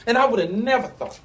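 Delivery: ordinary delivery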